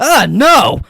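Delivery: loud, high yelp of refusal; startled refusal. Retold or imitated. Acting out mom turning down the stranger, startled yelp. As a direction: yelp it like someone caught off guard. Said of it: "it really startled her".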